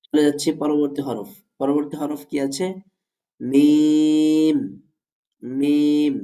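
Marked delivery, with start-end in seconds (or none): 3.4-6.1 মিম with a long-held vowel and sustained hum; drawn-out model মিম for students to copy; demonstrated letter Mim. Shown as a demonstration. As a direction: intone মিম slowly, closing both lips on the m. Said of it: "মিম কোথা থেকে উচ্চারিত হবে".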